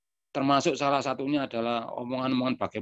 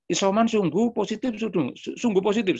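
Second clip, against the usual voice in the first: high, louder anxious disbelief; shocked question; 7 semitones higher, 3 dB louder than usual. Retold or imitated. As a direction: repeat "sungguh" in growing alarm. Told the elder is isolating.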